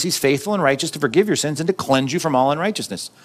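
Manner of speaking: ordinary talk, nothing marked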